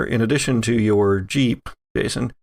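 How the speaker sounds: usual speaking voice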